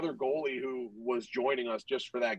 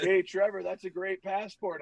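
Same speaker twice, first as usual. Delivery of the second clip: higher-pitched mock-friendly heckle; teasing jeer; 5 semitones higher than usual. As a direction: call it out like a needling teammate. Teammates jokingly taunt him in story.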